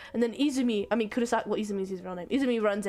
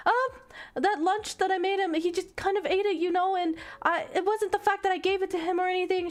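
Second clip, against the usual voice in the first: put-on high, hurried excuse in a girl's voice; lightly acted anxious justification, much higher; flustered girl explaining; 9 semitones higher than usual. Girl explaining the lunch to her boyfriend, flustered.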